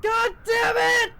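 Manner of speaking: high, stretched-out yell; exasperated scream. Acted. Character thrown back into the sky, high exasperated scream. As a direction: scream it in mock despair. Character shouts at apex of bounce.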